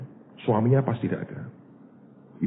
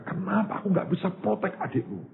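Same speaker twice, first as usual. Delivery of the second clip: self-directed anger; 10 semitones higher, 3 dB quieter than usual. Retold or imitated. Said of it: "Dia juga marah ke dirinya".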